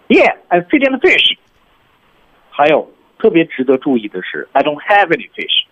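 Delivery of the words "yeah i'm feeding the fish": chirpy Donkey. Shown as a demonstration. Demo of Donkey's line.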